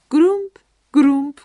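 loud, heavy thudding sound words; stomping footsteps. A narrator's reading. Narrator imitating the noisy man stomping home, loud thuds. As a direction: boom out each step.

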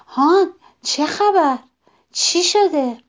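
high, loud startled questions; quick, eager questioning. A narrator's reading. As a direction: blurt it out, wanting news at once. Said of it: "درخت زود پرسید".

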